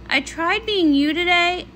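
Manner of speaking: ordinary delivery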